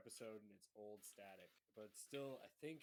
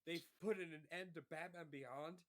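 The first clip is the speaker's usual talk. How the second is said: higher, louder amazed outburst; thrilled fan disbelief, pushed up in pitch; excited reaction; 5 semitones higher, 6 dB louder than usual. Retold or imitated. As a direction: relive being blown away by a show's ending. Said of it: "I'm like".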